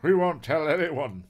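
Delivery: acted desperate promise; pleading captive. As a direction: beg the kidnappers earnestly. Captive boy begs the kidnappers.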